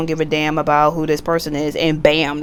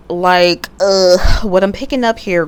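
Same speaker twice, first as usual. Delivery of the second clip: ordinary delivery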